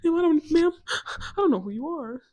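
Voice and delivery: high, loud mock-tearful protest; squealing, whiny fake distress; panicked denial. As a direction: plead innocence in a hammed-up panic. Acts panicked denial when accused.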